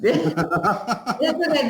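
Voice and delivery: laughing it off, slowed. Real, not acted. Host laughing off the dropped call to reassure viewers. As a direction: chuckle through the words to reassure viewers.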